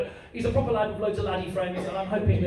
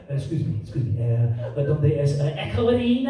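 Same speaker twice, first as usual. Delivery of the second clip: hesitant mock Spanish accent, rising at the end; Spaniard with Scouse bits; 4 semitones lower than usual. Spaniard from Liverpool asking the way, mock Spanish-Scouse accent. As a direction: imitate a Spaniard turned Scouser. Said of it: "lived in Liverpool for ten years".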